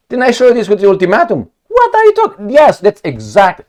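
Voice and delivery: high, loud, incredulous protest; mock indignation. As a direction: protest as if baffled by the denial. Mock outrage over the ultimatum.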